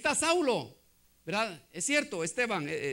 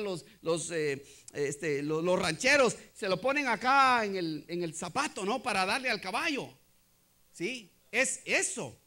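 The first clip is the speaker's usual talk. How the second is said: usual speaking voice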